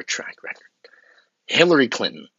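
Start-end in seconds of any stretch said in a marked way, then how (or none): 0.0-0.6 unvoiced whisper to himself; whispered aside. Checking notes mid-speech, he whispers aside. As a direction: mutter it off-mic as a note.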